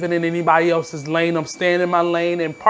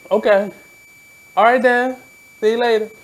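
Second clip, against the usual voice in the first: breezy, higher, louder brush-off; mock dismissive brush-off; 6 semitones higher, 4 dB louder than usual. Telling the audition man goodbye, breezy and higher. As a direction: wave him off lightly.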